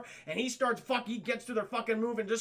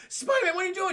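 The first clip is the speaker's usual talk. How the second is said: loud, high alarmed protest; freaking out; 11 semitones higher, 5 dB louder, faster than usual. Acted. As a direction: cry out in mock alarm. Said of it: "He's just freaking out".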